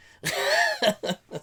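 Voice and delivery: loud high laugh; high burst of laughter. Real, not acted. Laughing at his own song parody, high burst. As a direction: laugh at your own joke.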